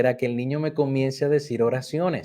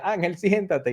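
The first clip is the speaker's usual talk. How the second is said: higher echo of the order; laughing echo; 6 semitones higher than usual. Retold or imitated. Boy echoes the order, playful and higher. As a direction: echo it back playfully like the child. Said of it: "y repetía".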